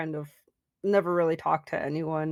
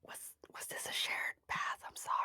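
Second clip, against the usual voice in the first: hushed, breathless apology; whispered confession; 15 dB quieter than usual. Acted. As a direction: whisper it, embarrassed. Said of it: "As you're like".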